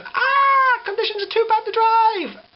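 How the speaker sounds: squeaky high mock alarm; high, loud mock shriek of alarm; mock panic. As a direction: mimic a panicking announcer. Mocking panicked announcements.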